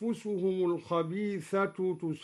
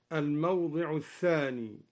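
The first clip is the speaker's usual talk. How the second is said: ordinary delivery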